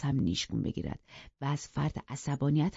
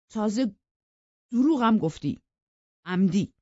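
raised, pointed voice; reproachful accusation; 4 semitones higher, 5 dB louder than usual. Acted. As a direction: pile on the charge in short loud bursts. Suitor continues accusing her.

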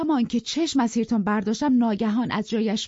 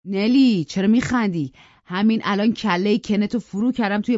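loud, raised raging threats; furious rant; 2 semitones higher, 5 dB louder than usual. Acted. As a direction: threaten them all in a drunken fury. Drunk Hindley threatening Nelly. (فریاد خشمگین پدر مست رو به همه)

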